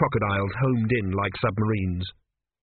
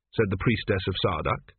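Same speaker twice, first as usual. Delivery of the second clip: usual speaking voice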